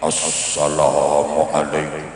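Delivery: very low, slow, weighty salam; big preacher voice. Shown as a demonstration. Preacher opening his sermon, deep puffed-up slow salam. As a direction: make the voice big and deep, drawn out. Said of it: "Biasanya suaranya digedek-gedek".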